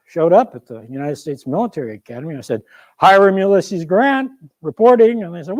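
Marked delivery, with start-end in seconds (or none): 3.0-5.2 loud, slightly raised cadet's report; reporting for duty. Acted. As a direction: announce yourself loudly to the officer.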